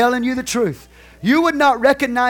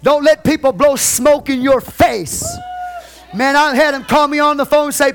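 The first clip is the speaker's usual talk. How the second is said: loud, raised preacher voice; preaching at full force; 6 semitones higher, 6 dB louder than usual. Real, not acted. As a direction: preach it out to the congregation.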